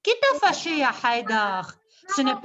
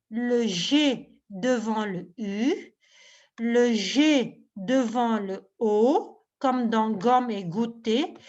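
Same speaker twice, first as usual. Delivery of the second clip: slow teaching voice; 4 semitones lower, slower than usual. Shown as a demonstration. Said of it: "teacher demonstrating slowly".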